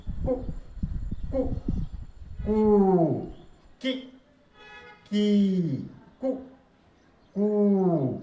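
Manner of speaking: कु short, की stretched, alternating lengths; teacher contrasting vowel lengths; short and long vowels. Shown as a demonstration. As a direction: rise and fall through the syllable drill. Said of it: "सुर में उतार चढ़ाव करके".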